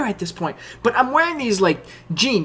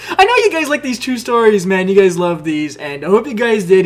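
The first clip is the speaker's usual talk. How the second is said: ordinary delivery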